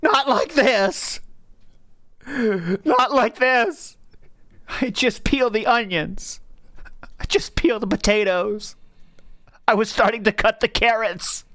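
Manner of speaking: high, slow, hammed-up tragic lament; mock melodrama. Friend mocks the rant in a hammed-up tragic voice. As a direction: overplay the grief of a ruined chore. Mocking friend's ruined cooking day.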